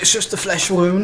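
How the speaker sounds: lifted, lightly put-on quote voice; mock brave brush-off; a hint of a plucky knight; quoting a movie line. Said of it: "the first thing I say".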